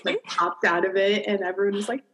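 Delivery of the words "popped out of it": ordinary delivery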